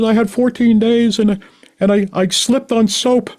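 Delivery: high, loud imitated wailing, drawn out at the end; hammed-up tearful confession; mock crying. Mary confessing her relapse to the meeting, mock crying. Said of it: "crying, and they start clapping".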